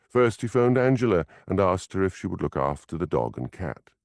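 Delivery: usual speaking voice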